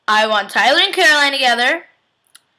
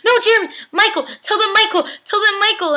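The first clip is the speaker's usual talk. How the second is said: much higher, frantic voiced plea; panicked plea; 10 semitones higher than usual. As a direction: play the desperate character begging. Character pleading in retold scene.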